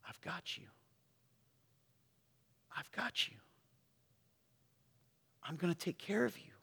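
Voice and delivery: softly voiced reassurance; tender whisper. Preacher voicing God's promise to the congregation, hushed and tender. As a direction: breathe it gently as a comfort.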